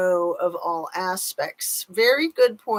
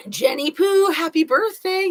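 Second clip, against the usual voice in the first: high, bright birthday call; cheerful high birthday wish; sing-song greeting; 10 semitones higher, 5 dB louder than usual. Real, not acted. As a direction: sing it out to a friend, higher and louder.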